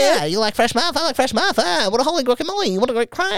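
high squeaky voice; squeaky kid voice. Retold or imitated. Friend doing the teen worker, high squeaky voice. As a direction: chirp it like an eager boy. Continuing the Elliot impression.

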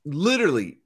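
normal talking voice

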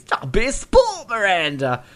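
put-on cockney accent, jumping high on sport; chirpy cockney brush-off; cockney Graves. Host repeats Graves's line in a cockney accent. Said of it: "like, cockney he is".

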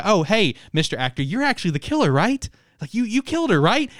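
mock-sweet accusation; lightly voiced eager girl. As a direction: tease him like a giddy detective. Voicing the female detective accusing.

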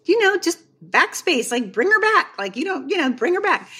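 high, sing-out suggestion; imitated spouse offering an easy fix; coaxing husband. Husband jokes to his tearful wife, high and coaxing. Husband jokingly coaxes laughing author.

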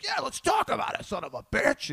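imitating the comedian goading a heckler; taunting comedian. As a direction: egg him on with a sneer. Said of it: "He's like".

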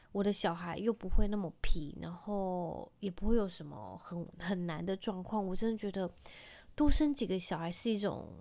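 normal talking voice

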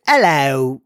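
put-on quick, failed stab at an Irish accent; bad Irish accent. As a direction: attempt an Irish greeting, badly faked. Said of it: "an Irish accent very quickly".

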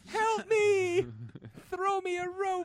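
mock cry for help. Joking plea while sinking.

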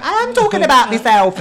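high, loud British character voice; Rita. Retold or imitated. Rita dropping the posh act, loud imitation. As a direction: burst out loudly in an imitated British voice. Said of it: "switches back into Rita".

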